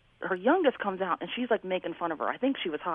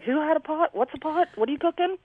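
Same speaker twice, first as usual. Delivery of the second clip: confused mom; 9 semitones higher than usual. Retold or imitated. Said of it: "She'd be like".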